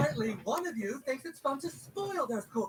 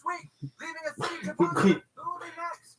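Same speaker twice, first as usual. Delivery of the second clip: dramatic news read; 3 semitones higher, 4 dB louder than usual. Acted. Dramatic newscast line.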